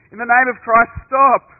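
shouted high and loud, pleading; loud cry. Retold or imitated. Monk shouting to the arena crowd, loud desperate cry. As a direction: cry out over the crowd to stop the fight. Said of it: "cried out in a loud voice".

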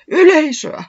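high, loud startled cry, trembling with fright; frightened exclamation. A narrator's reading. Tiputi hears of an audience, crying out in fright. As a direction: squeal it in sudden alarm. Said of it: "huudahti Tiputi ääni säikähdyksestä värähtäen".

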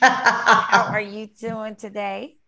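slow, loud, laughing through the question; laughing greeting. Real, not acted. Host greeting her sister with a slow laughing question. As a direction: ask it while still laughing.

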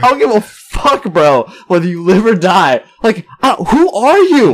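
high, loud, hammed-up rant; exasperated outburst. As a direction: replay the dismissal, way up high and stretched. Retells his outburst.

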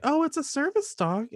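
high, light customer explaining; surprised explaining. Retold or imitated. Customer tells the boss it is a service dog, high and light. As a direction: pitch it up high, mildly taken aback.

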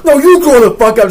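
very loud, high retort; imitated screaming. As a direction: scream it back like a hot-headed friend. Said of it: "screaming at the guy".

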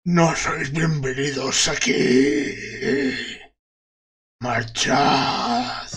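slow, eerie undead voice from beyond the grave; cold hissing layered whisper; voz de ultratumba. Acted. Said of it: "sale una voz de ultratumba". (voz de ultratumba lenta y fría)

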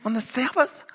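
mock gossipy Pharisee telling on him; tattletale Pharisee. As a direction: tell on Jesus like a sneaky snitch. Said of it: "that's how they all talked".

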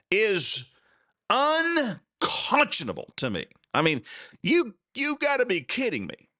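can't believe what he's hearing; incredulous outrage. Real, not acted. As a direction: voice jumps high, slow and stretched in disbelief. Outraged at charging a soldier.

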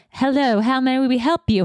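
posh British voice. Retold or imitated. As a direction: put on a posh British accent. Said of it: "very British posh voice being like".

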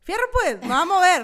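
high, loud defiant dare, laughing; fierce. Retold or imitated. Daring the shadow figure at night, loud and fierce. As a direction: taunt the shadow, fearless. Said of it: "Así como bien fiera".

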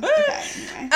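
teasing imitation of a co-host; mocking imitation. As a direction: parrot her line in a hammed-up voice. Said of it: "He is such a ham".

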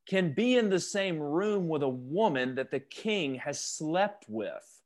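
ordinary delivery

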